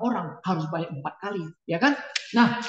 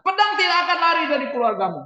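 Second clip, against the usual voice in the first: prophet's warning; 8 semitones higher, 5 dB louder, faster than usual. Retold or imitated. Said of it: "Nathan bilang".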